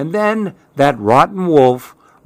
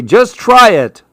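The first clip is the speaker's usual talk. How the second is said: high, louder mock-parent voice; imitating dad; 9 semitones higher, 5 dB louder than usual. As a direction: mimic dad's coaxing line. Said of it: "what my dad's gonna say".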